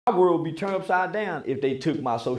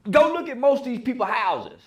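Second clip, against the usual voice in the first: normal talking voice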